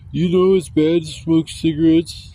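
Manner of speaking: slow, preachy imitation of a scold; chastising. Viewer scolding him for smoking, mock chastising. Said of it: "everybody in there chastising me".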